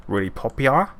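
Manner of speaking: put-on playful Australian accent, rising on popular; mock Australian. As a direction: lean into the Australian accent on purpose. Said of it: "super Australian then".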